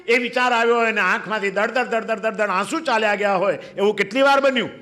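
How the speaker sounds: ordinary talk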